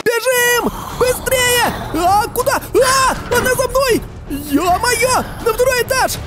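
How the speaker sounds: high frantic yelling in fear; panicked screaming. Real, not acted. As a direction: scream and run for your life. Fleeing the ghost, screaming.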